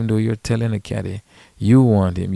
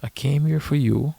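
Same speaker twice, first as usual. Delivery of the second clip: mildly indignant, pitched up; mock indignation; 7 semitones higher than usual. Underpaid caddy protesting to the player, higher and mildly indignant. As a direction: act out a put-out caddy in a higher voice. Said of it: "and you're like".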